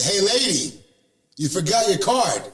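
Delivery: high, carrying call to a customer. Retold or imitated. Calling after the departing customer.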